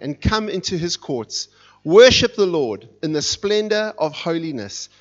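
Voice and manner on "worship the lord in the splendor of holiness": ordinary talk, nothing marked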